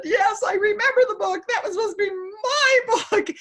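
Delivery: high, teary, half laughing; high crying complaint to God; tearful retort. Retold or imitated. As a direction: protest through tears. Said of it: "I said".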